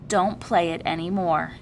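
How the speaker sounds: ordinary delivery, nothing marked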